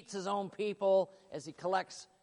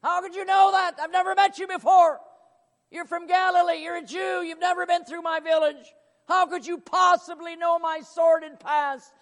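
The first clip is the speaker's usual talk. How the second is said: high, loud, disbelieving cries; astonished woman; 9 semitones higher, 10 dB louder than usual. Acted. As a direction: demand it in shocked disbelief. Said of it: "her response was".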